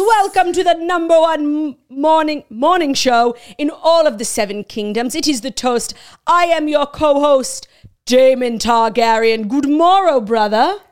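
a hint of a put-on Westeros British accent; mock British accent. Daemon introducing the show, mock British accent. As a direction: play a pompous Targaryen host in British accent. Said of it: "I cannot do this accent".

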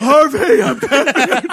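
high, loud yell through laughter; laughing, hammed-up shout; mock scream. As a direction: holler it like a heckler at a gig. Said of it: "Screaming about being Batman".

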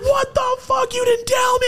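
very high mock shout of disbelief; shocked outburst. Man acting out confronting Rocco about Optimus Prime, shocked. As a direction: yell it high in comic outrage. Acts out his outraged surprise.

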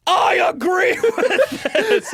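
high, loud, laughing shout; hammed-up zealot digging in; mock fervent agreement. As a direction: bellow it slowly through laughter. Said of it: "you're just like, "I agree".

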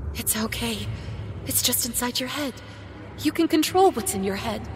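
soft, shaky self-calming; self-reassuring whisper. Acted. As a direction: hush yourself, barely audible. Calming herself in terror.

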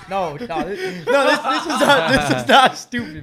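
real laughter, high and loud; slow, high, loud words broken by laughs; laughing. Real, not acted. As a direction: protest through helpless laughing. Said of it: "Why the fuck are you laughing over there?".

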